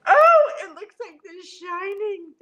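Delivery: loud, high excited cry; excited outburst. Real, not acted. Gushing to viewers that the hallway looks like The Shining, loud. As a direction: burst out with excitement.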